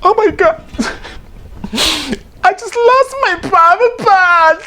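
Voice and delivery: very high, slow shocked wail; mock despair over the loss; wailing dismay. Divorcee wailing over the lost private part, very high. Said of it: "You're like".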